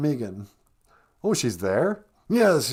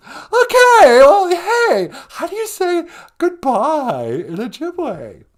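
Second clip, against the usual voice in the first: much higher, louder, gushing caricature of Megan; mock Megan voice; 12 semitones higher, 8 dB louder, slower than usual. Host plays Megan on the phone, high gushing squeal. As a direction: squeal it like an overexcited fan.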